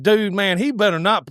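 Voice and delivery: high, fed-up retold grumble; retold anger. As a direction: relive being mad the truck might ruin the hunt. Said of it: "I was mad".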